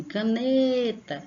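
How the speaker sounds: Caneta called out, long high NE; chamando alguém. Shown as a demonstration. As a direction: call Caneta as if it were someone's name. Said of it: "como se estivesse chamando alguém". (Caneta chamada, com NE forte e alongado)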